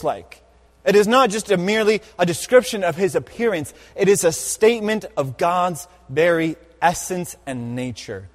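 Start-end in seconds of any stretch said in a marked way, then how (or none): none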